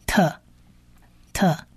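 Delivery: "特" as a short, soft, isolated T sound; 发特的音. Shown as a demonstration. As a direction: sound out "特" by itself, softly. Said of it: "在发音上发特的音". (单独轻声示范 "特" 音)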